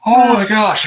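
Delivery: words stretched by a yawn. Real, not acted. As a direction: say it mid-yawn. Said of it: "I keep yawning".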